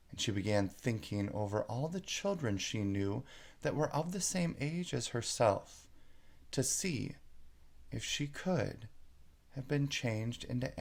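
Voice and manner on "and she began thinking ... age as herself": usual speaking voice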